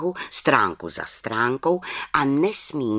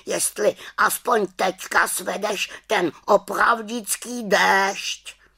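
loud, high-pitched nagging raven; reproachful raven croak; 10 semitones higher, 3 dB louder than usual. A narrator's reading. Raven listing the witch's failed spells, reproachful high croak. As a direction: scold like an indignant raven, high up. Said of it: "krákal vyčítavě".

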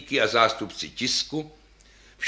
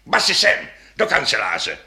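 barked order; 6 dB louder than usual. A narrator's reading. Conductor ordering basses by telephone, barked order. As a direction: holler a curt command down the line. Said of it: "Houkl dirigent do telefonu".

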